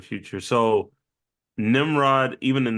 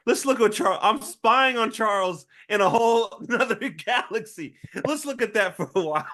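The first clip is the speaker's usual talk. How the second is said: high-pitched, amused imitation; mock boast; 10 semitones higher than usual. Co-host playing villain showing off his satellite spying, mock boast. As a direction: voice the show-off in a high, tickled squeal.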